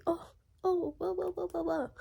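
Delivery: high, soft blah-blah whine; mockingly high, hushed babble; mock whining. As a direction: mimic their whining in a small high voice. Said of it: "literally just whining".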